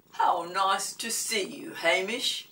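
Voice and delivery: put-on loud, slow, hearty old gentleman; Sir Reggie. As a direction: savour each word like a kindly old sir. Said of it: "he said".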